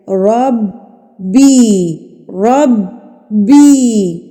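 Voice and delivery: ربی with a firm doubled با, very slow; emphasized shadda. Shown as a demonstration. Teacher stresses the shadda in ربی. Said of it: "مضبوطی سے پڑھنا ہے".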